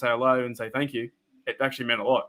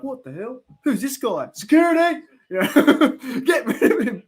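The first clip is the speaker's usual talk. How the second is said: high, rising mock panic calling security; mock alarmed cashier; 10 semitones higher, 5 dB louder than usual. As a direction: play a spooked cashier shrieking for security. Imitating startled cashier.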